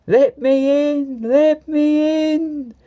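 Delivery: very high, very slow ghostly wail; spooky voice. A narrator's reading. Percy playing ghost at the shed doors, spooky wailing. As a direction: drag it out like a moaning ghost. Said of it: "said Percy in a spooky voice".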